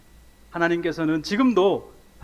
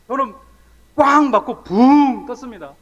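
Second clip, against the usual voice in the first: loud, slow, higher burst; dramatic crash retelling; 7 semitones higher, 10 dB louder, slower than usual. Retold or imitated. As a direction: hit each word as if flying off the bike.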